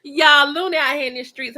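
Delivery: bursting out through a laugh at the comment; laughing exclaim. Real, not acted.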